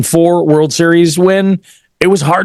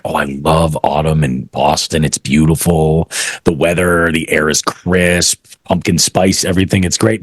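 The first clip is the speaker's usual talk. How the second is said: imitating Boston influencer; 9 semitones lower than usual. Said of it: "She's like".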